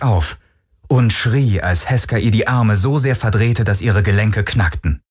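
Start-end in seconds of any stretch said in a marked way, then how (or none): none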